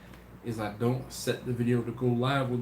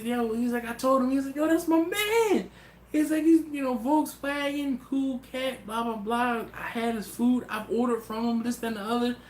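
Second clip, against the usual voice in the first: excited friend; 10 semitones higher than usual. Retold or imitated. Friend tells buddy he knows the host, high excited voice. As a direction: vouch for him like a proud friend.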